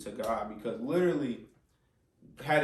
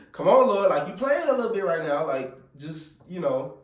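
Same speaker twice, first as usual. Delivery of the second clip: high, loud exasperated appeal; acted-out urgent bargaining with God; loud plea to God; 7 semitones higher, 10 dB louder than usual. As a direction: plead with the Lord, voice raised. Acted plea to God while panicking.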